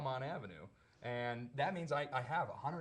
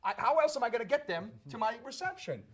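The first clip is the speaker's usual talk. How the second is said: 1 retold shout back; 10 semitones higher, 8 dB louder than usual. Arguing back with the cop.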